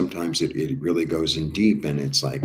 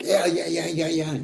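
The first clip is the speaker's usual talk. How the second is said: high, quick eager yeses; 12 semitones higher than usual. Retold or imitated. Angels answering the call, high and eager. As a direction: jump at the chance. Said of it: "They're like".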